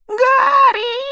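very high, loud, whimpering wail; high pleading squeal. A narrator's reading. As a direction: squeal the name in desperate pleading. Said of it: "прошептал Петтигрю". (тонкий умоляющий вопль)

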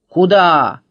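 loud, sudden guard's challenge; shouted challenge. A narrator's reading. Letter К guarding the barn door, shouted challenge. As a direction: halt them with a shout. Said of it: "крикнула буква".

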